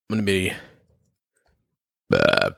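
talking through a burp; burping the words. Real, not acted. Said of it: "Sorry to burp in your ear".

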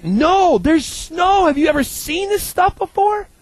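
clueless dorm guy. Retold or imitated. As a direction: gush about the snow like it's a miracle. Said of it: "He's like".

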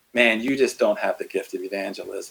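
a low, hint-of-scorn put-down; lightly voiced accuser crushing your confidence; enemy's discouraging voice. Imagined enemy telling a believer to quit, low and scornful. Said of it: "only the enemy talks like that".

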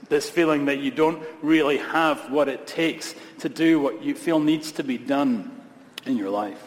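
ordinary delivery

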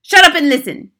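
high, loud angry snap; snapping. Acted. Grand High Witch snapping at her cheering audience, angry. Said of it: "snapped the Grand High Witch".

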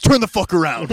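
loud retort. Retold or imitated. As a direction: hurl it back over the booth. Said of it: "And he goes".

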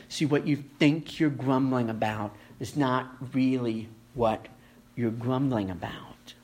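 drops to a whisper on the last words; hushed. Real, not acted. Preacher hushing the congregation into a whisper. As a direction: softly let the point land in a whisper. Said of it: "whispered repetition of refrain".

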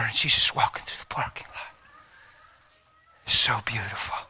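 whispered, hushed in awe; awed whisper. A narrator's reading. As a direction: let the wonder drop to a whisper. Awed memory of the girl.

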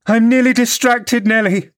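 very high-pitched, overwrought cry; distraught exclamation. A narrator's reading. Mistress to servant after the quarrel, distraught. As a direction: exclaim as if at wits' end. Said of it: "she exclaimed".

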